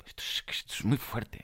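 hushed, breathless disbelief; stunned whisper of amazement; awed whisper. Real, not acted. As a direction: whisper it as if it can't be true. Awed by the email feature.

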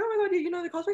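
high excited question; excited fangirl. Retold or imitated. Asking the co-star about the cosplay, high and excited. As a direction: squeal it like a thrilled fan. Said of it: "I was like".

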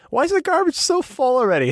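high-pitched mock puzzlement; put-on high voice, wondering aloud; garbage man. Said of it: "one of the garbage men is like".

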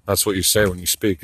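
ordinary talk, nothing marked

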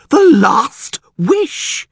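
high drawn-out cry, slow; alarmed cry. Acted. Priest to the mayor, stunned slow cry. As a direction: cry out the last wish.

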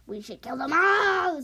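loud bloodthirsty yell; angry shout. Acted. Skywing soldier calling to kill the dragonets, shouting. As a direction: demand their deaths, loudly. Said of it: "So they're kinda mad".

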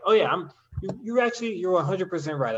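put-on, high-voiced backing down; mock concession. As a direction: pretend to concede the whole argument. Acts out mock surrender to commenter.

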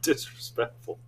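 breathy laughing tease; laughing mock offense. Real, not acted.

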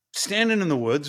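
ordinary talk

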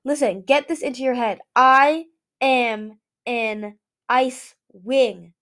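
slow, loud, deliberate declaration; insistent. A narrator's reading. Winter declares to Moon he is an Ice Wing, slow and loud. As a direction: spell out each word of it.

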